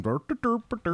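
bouncy mock-foreign babble; mock-Swedish gibberish. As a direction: burble like a cartoon Swede. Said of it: "Should I just be going".

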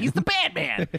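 very high mock-nerd whine; nerdy screeching. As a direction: screech it like a petulant fanboy. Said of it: "like, nerdy, like, screeching".

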